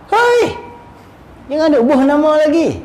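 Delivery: sudden high shout, then stern scolding; sharp rebuke. Acted. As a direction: bark the Hei to stop him. Rebuking a false murid. (jeritan tegur yang tajam)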